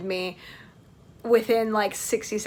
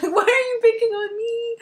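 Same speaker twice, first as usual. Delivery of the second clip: mock whining; 12 semitones higher, 4 dB louder than usual. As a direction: whine it like a picked-on kid. Acts out her complaint to costumed girl.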